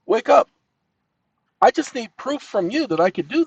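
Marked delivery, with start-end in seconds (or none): 0.0-0.5 loud command. Real, not acted. Yelling at phone assistant.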